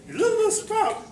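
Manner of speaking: tiny character in a high-pitched voice; little sprout voice. Retold or imitated. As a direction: squeak it out like a tiny cartoon sprout. Mimicking the tiny sprout from commercial.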